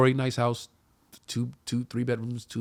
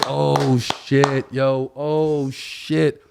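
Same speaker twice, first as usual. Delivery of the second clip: excited; 3 semitones higher, 4 dB louder, much slower than usual. Retold or imitated. Reacting to booking the show.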